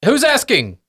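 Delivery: high, jumpy gnome professor; wary high-pitched shout through a door; flustered little voice calling back; startled professor calling out. Acted. Gnome professor calls from behind a door.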